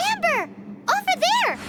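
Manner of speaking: high excited call. Acted. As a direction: call across to her. Calling out to a companion.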